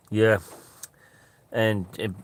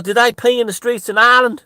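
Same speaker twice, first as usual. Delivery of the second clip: high-pitched, booming impression of catfish; loud incredulous question; 14 semitones higher, 10 dB louder than usual. Catfish quizzing his Irish workmate, loud high-pitched question. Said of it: "And he goes".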